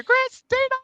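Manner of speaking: excited greeting. Real, not acted. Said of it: "Christina's here".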